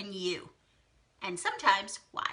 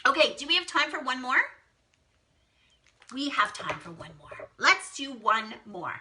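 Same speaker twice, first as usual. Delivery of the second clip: ordinary talk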